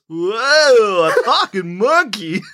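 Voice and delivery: drawn-out mock amazement, voice pushed up; hammed-up imitation of a driver's reaction; Joey Pants impression. Mimicking Joey Pants hearing Amy speak, exaggerated drawn-out wonder. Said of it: "just casually looks over his shoulder. He goes".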